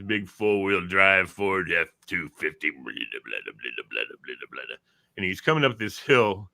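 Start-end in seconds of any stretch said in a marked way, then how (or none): 2.7-4.8 very low, soft rumbling babble; imitated truck engine. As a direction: chug along like a big truck idling.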